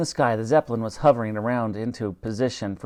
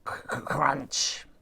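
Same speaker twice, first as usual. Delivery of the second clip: creaking crunch; 7 dB quieter, much slower than usual. A narrator's reading. Ship hull creaking.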